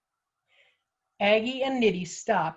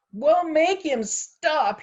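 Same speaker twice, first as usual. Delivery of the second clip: drawn-out, louder and higher complaint; exasperated, emphatic plea; hissed demand; 8 semitones higher, 5 dB louder, much slower than usual. A narrator's reading. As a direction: insist on it, stretching the words out. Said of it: "hissed Poppet in a low voice".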